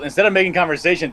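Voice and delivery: ordinary talk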